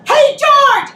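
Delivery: loud, high call from behind; yelled out. Retold or imitated. Wife retelling how she shouted at her husband, a loud high yell. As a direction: shout his name to startle him. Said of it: "and I yelled out".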